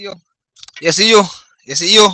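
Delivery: high, loud calling out; loud high hail; excited call-out. Real, not acted. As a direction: call it across to her, thrilled.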